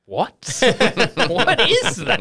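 high, slow, laughing confusion; puzzled and laughing at a strange order; high, slow, baffled question; baffled staff. Retold or imitated.

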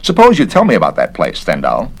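the busybody official sizing him up; officious investigator. Acted. Garrett again.

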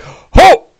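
high, loud "ho"; short high orc whoop; startled yelp. Acted. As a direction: yelp while dodging away. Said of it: "He's like".

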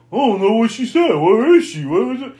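high, loud whiny flailing; exaggerated helpless bewilderment; mock clueless panic. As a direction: wail it like a hopelessly confused heroine. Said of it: "Alice is like".

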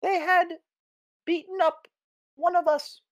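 very high squeaky voice; squeaky creature voice. Acted. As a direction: speak through a tiny squeaker. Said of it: "their little squeaker that they used to speak through".

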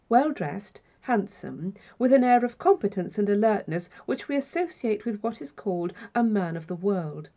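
ordinary delivery

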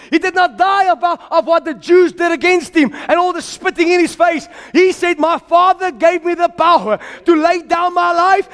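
high, driving sermon voice; impassioned preaching. Real, not acted. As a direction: proclaim it high and forcefully to the congregation. Preaches at full force.

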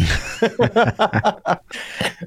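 a real, high laugh bursting out; helpless high-pitched laughter. Real, not acted. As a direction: crack up at the memory. Laughing at brother hanging up.

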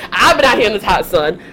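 usual speaking voice

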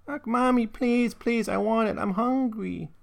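put-on high, whining toddler plea; mock-tearful little girl begging her mother; sobbing child's plea. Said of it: "Hilda once more began to sob".

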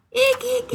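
squeaky high eek like a bat; high bat squeaks. Shown as a demonstration. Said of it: "I tried to make a bat sound".

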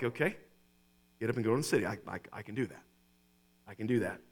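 a hint of a nervous man talking himself into it; hesitant Saul. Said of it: "And Saul's like".

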